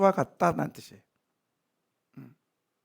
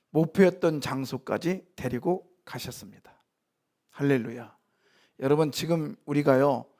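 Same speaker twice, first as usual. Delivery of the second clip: ordinary delivery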